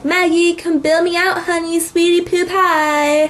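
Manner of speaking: high mock sweet-talking plea; hammed-up jailbird pleading with his sweetheart; wheedling phone message. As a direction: wheedle in a high, drawn-out sing-song. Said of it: "the message is all like".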